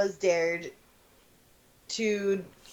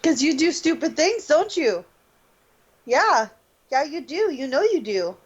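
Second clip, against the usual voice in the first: higher, sing-song teasing aimed at the dog; teasing pet talk; 6 semitones higher than usual. Real, not acted. Owner teasing his dog for doing stupid things, higher and sing-song. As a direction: tease the dog like he understands.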